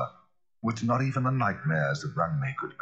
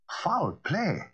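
high, alarmed echo; frightened cry; 12 semitones higher than usual. A narrator's reading. Lawyer hears of foul play from Poole, frightened cry. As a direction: cry it back in alarm, pitch leaping. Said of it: "cried the lawyer, a good deal frightened".

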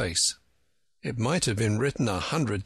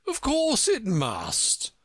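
scornful; 12 semitones higher, slower than usual. A narrator's reading. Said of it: "with a short laugh".

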